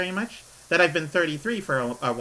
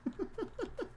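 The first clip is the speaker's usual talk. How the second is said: very high, soft squeal of a laugh; high squeaky laugh; 14 dB quieter than usual. Real, not acted. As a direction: let out a tiny squeaky giggle. Laughs at her breakdown story.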